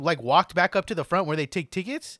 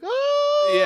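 startled cry; 6 dB louder than usual. Retold or imitated. Friend startled by tap on shoulder.

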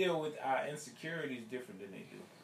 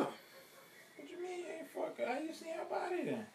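disbelief; slower than usual. Real, not acted. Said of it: "I don't think you seen the eye".

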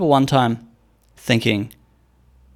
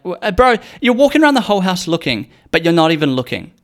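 high, loud, fed-up scolding of a friend; bro, are you serious, pitched up; exasperated; 6 semitones higher, 3 dB louder than usual. Retold or imitated. Exasperated at friend who can't find keys on microwave.

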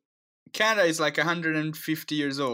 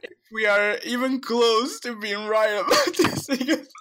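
laughing; 6 semitones higher than usual. Real, not acted. Laughing confusion.

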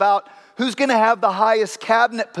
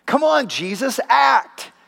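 higher, pushing call to act; impatient urging; 5 semitones higher, slower than usual. Retold or imitated. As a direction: demand it, fed up with waiting. Judas impatient with Jesus.

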